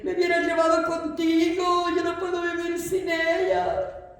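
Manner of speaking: high, hammed-up grieving wail; mock lament. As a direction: wail it out like a melodramatic widower. Acted lament of a bereaved husband.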